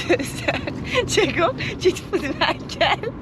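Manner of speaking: mock-indignant through real giggles; laughing. Real, not acted. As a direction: accuse your partner while cracking up. Laughs as partner mocks her accent.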